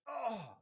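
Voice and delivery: high, loud startled cry; revival gasp. Acted. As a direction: cry out as you come to. Patient revived, coughing, cries out.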